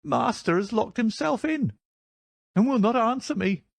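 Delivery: high-pitched, worried servant; high, agitated character voice; distraught old housekeeper. Acted. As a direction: fret in a high old woman's voice.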